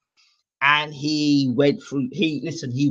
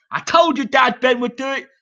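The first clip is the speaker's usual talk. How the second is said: gloating boast; 11 semitones higher than usual. Retold or imitated. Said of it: "boy gloating to dad".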